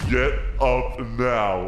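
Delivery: low, slow menacing command; menacing order. Acted. Jealous rival orders him out of the seat, menacing.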